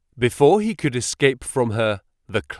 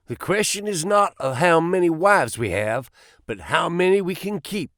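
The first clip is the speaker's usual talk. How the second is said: a lightly voiced young suitor, raised high; lightly raised, eager suitor voice; crying out; 6 semitones higher than usual. A narrator's reading. Suitor Drebber boasting to the farmer, lightly raised voice. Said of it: "cried the other".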